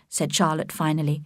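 ordinary delivery

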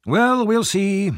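high-pitched, slow, drawn-out doubt; sceptical old friend. A narrator's reading. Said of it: "answered his friend".

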